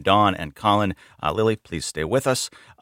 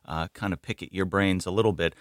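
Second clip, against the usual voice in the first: normal talking voice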